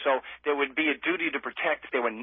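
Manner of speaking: usual speaking voice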